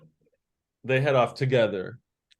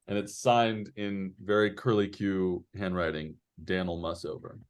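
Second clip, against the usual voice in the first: ordinary talk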